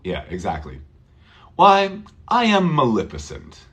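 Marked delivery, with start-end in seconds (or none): 1.6-3.7 Why I am Maleficent said with a falling tune, not a question; statement why. Shown as a demonstration. Teacher modelling Maleficent's old-fashioned statement. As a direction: say why as a proud declaration, falling at the end. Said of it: "Of course".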